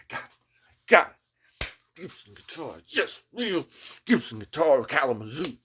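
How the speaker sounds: high, loud, fast cheer; excited. Retold or imitated. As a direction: crow it with joy. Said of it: "I'm driving home, like".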